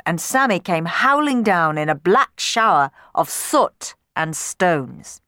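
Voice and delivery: ordinary delivery, nothing marked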